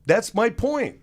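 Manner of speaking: loud and high, driving the point home; emphatic exclamation. Real, not acted. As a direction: slam the point: it's not!. Emphatic agreement in rant.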